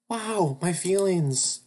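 hammed-up heartbroken gasp; melodramatic hurt. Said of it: "clutched her heart in melodramatic agony".

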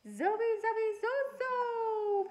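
put-on very high, slow baby squeal; high stretched baby shriek; toddler screech. A narrator's reading. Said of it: "Baby Louie screeched".

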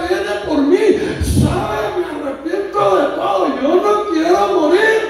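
put-on high, urgent begging of a dying girl; retold desperate plea. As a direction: imitate her pleading for life. Dying girl begs the pastor. (súplica desesperada imitada, en tono agudo)

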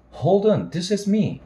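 put-on young woman. Sally answers the phone.

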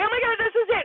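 high, thrilled exclamation; excited gasp. Retold or imitated. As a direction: burst out as the presenter appears. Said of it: "So I'm like".

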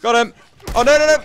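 high, loud yelp of panic; panicked shout. Real, not acted. Gamer caught by a zombie, yelling in panic. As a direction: shriek it out as the zombie lunges.

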